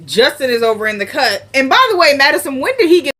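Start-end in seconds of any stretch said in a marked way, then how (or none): 1.5-3.1 sudden high, loud aside; excited aside. Real, not acted. Vlogger breaking off the recap, high and loud. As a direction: burst in with an eager question.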